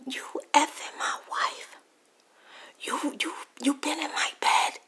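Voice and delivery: loud, high-pitched incredulous outburst; retold outraged accusation. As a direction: act out an outraged husband, voice jumping up. Retold husband accuses man in bed.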